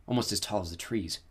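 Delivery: plain reading voice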